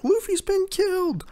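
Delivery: very high mock wail; Chopper bawling. Reviewer imitates Chopper learning of Luffy's death, mock bawling. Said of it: "Chopper starts bawling his eyes out".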